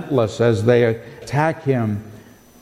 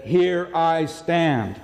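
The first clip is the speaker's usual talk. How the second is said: slow, firm "Here I stand"; Luther's slow, emphatic stand; emphatic declaration; 3 semitones higher, much slower than usual. Retold or imitated. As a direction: plant every word of "Here I stand" slowly. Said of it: "That's an emphatic statement".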